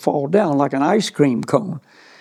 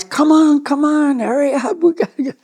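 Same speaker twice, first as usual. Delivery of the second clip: impatient calling out; 10 semitones higher than usual. Retold or imitated. Calling up the hill, urging him to hurry. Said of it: "and the guys".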